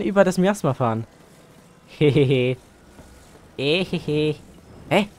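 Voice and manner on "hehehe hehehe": sly villain snicker; gloating little heh-heh-heh; evil chuckle. Pretend. Plotting to steal car.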